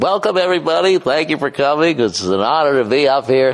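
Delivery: lightly voiced formal speaker at a podium; mock speech opening. Man mocking a relationship talk as a formal speech, pompous. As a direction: puff up like you're opening a ceremony. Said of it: "That's like the beginning of a speech".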